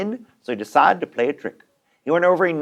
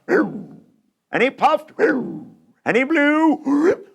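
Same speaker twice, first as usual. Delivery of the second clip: drawn-out blowing; 9 semitones higher, much slower than usual. Acted. Wolf blowing the house.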